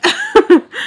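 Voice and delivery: a loud high laugh bursting out; burst of laughter. Real, not acted. Vlogger laughing at her own eating joke, loud laugh.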